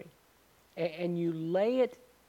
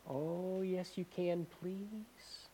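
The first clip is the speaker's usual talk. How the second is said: put-on softer, pleading whine of the boyfriend; soft begging boyfriend; 9 dB quieter, much slower than usual. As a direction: beg her quietly.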